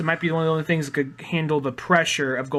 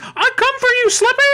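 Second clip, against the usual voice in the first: high, loud cartoon toad threat; 8 dB louder than usual. Barbarian toad uncle threatening Slippy, high loud voice. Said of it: "they all talk like that?".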